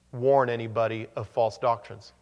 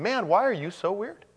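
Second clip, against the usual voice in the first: high, puzzled mock question; a lightly voiced bewildered stranger; incredulous outsider; 8 semitones higher than usual. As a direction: play a baffled onlooker, pitched up. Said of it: "somebody will be looking at us and saying".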